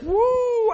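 high, loud mock whoop. Preacher mocking a grocery list for God, high loud whoop.

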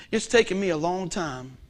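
ordinary delivery

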